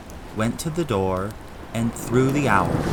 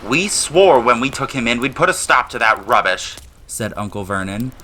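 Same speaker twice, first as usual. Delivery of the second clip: louder, blustering uncle; furious uncle shutting the boy down; angry uncle; 4 semitones higher, 7 dB louder than usual. A narrator's reading. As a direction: stamp down on it in a rage. Uncle angrily refuses.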